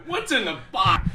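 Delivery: high shouted anguished cry; Brad Pitt Seven quote. Retold or imitated. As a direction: yell it in despair. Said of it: "that Brad Pitt".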